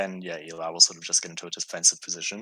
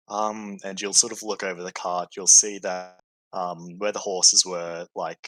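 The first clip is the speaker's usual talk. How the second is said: ordinary talk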